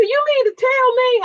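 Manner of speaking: high-pitched, indignant challenge; high, incredulous comeback; in-his-face confrontation. Retold or imitated. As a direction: retell it like you're challenging him to his face. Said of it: "I said it right in his face".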